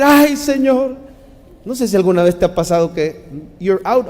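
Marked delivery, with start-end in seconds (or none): 0.0-0.9 gemido. Shown as a demonstration. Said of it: "le puede simplemente decir".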